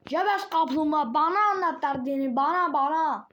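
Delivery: high, loud taunting call; teasing shout. Real, not acted.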